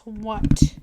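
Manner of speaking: loud, snapped demand; sharp demand. Acted. Mistress demanding her pet say mistress, loud. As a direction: demand the right answer.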